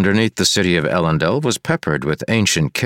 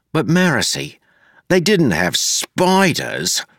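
higher, slow whining protest; whiny Wayne; 10 semitones higher, slower than usual. A narrator's reading. Wayne whining to Marcy about spiders. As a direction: drag it out like a sulking child.

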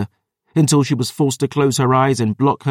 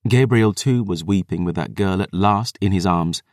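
plain reading voice

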